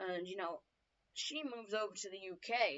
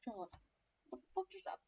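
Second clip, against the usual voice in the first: shaking voice; 9 dB quieter, faster than usual. Acted. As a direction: play a shaken child, quietly. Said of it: "his sister's all shaking her voice".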